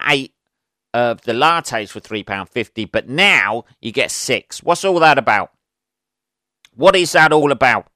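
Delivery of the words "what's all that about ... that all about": indignant rant. Real, not acted. Complaining about coffee pods.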